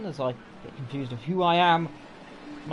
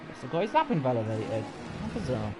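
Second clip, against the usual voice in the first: ordinary talk, nothing marked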